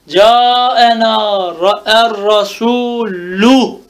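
slow recitation stressing the "ü" of "rasulü"; "er rasulü" syllable by syllable, ending vowel held; drawn-out damme ending. Shown as a demonstration. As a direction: draw out "rasulü", landing firmly on the final "ü". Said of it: "Merfun bid dammeti zahira".

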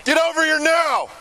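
slow, high, loud shout across the water; calling from far away. Real, not acted. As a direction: command him back at full voice. Officer shouting at fleeing subject.

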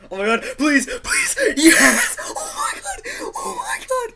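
shrieking high through laughter; plead, then explode with joy; loud squealing celebration; ecstatic screaming. Real, not acted. Finally gets the kill.

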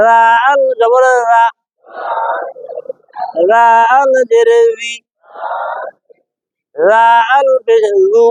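slow spelling, "রা", "রি", "রু" soft off the tongue tip; soft tongue-tip sound. Shown as a demonstration. Teacher drills the letter, soft, slow "রাল". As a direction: draw out "রাল" and keep its first sound soft. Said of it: "জিহ্বার আগা থেকে নরম ভাবে".

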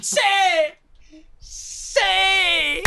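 high, tearful repeated cries; ecstatic relief. Real, not acted. Player after the capture, ecstatic high cries. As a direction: gush it over and over, almost wailing.

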